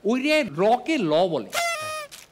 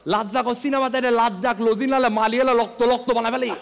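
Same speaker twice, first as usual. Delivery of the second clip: loud rapid Chittagonian dialect; Chittagonian accent; 3 dB louder than usual. Retold or imitated. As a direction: rattle it off in thick Chittagong Bengali. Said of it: "কালামানিক খেয়াল করলে বলে".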